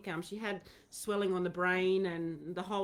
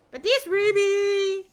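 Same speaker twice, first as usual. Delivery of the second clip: high, loud and slow presenting the dog; loud high slow cry of delight; delighted reveal; 12 semitones higher, 13 dB louder, slower than usual. Real, not acted. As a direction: show off your darling dog with joy.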